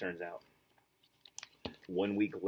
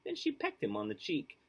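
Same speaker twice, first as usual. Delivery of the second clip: usual speaking voice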